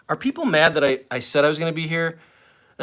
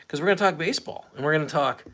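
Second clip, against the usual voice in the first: ordinary delivery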